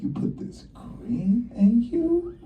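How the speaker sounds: high, slow whispered disbelief; high slow horrified whisper. Retold or imitated. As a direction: whisper it in horror. Shocked question to girlfriend.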